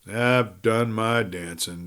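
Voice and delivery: slow, lazy drawl; a slow uninterested drawl. Acted. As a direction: drag the words out unimpressed. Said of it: "drawled Springer".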